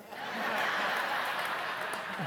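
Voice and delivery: breathy unvoiced laugh. Real, not acted. Speaker laughing at his own popcorn punchline, breathy laugh. Congregation laughs after joke.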